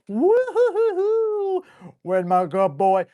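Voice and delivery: sudden high woo-hoo-hoo, stretched out, then doting; hammed-up puppy praise, high and slow; dog praise. Shown as a demonstration. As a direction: gush over the dog like he just won the lottery.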